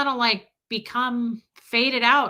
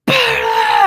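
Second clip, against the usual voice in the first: burst of laughter; 12 semitones higher, 12 dB louder than usual. Real, not acted. Bursts out laughing mid-story.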